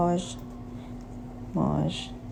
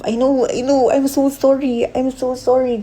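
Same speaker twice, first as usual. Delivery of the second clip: higher, louder embarrassed sorry; flustered apology; 8 semitones higher, 6 dB louder than usual. Real, not acted. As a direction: gush out the apology, flustered and loud. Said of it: "Ang cringe ng word na love". (nahihiyang paumanhin, mas mataas at malakas)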